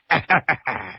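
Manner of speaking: short staccato stage chuckle; acted chuckle. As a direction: chuckle in character. Li Kui laughs before wheedling.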